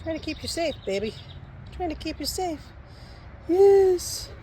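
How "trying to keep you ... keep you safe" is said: softly voicing the dog, hushed; hushed pet voice. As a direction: murmur it like a dog narrating itself. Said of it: "She said".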